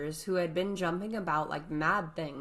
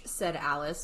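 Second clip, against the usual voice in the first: usual speaking voice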